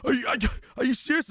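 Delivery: high, stammered mock disbelief; alarmed double-take, acted out; retold freak-out. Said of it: "he starts losing his mind".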